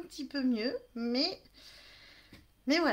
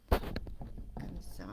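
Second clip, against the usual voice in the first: breathy whisper while fiddling with the camera; soft whisper while adjusting the camera; whispered aside; 4 dB quieter than usual. Real, not acted. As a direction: whisper it to yourself, half under the breath. Adjusting the camera, muttering to herself.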